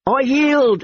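high shrill terrified plea. Acted. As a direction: shriek it out in panic. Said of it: "he shrilled".

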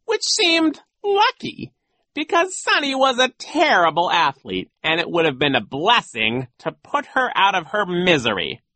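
high, loud, theatrical villain; gloating villain coach. Acted. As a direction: relish the nasty tale. Voices gloating Genghis.

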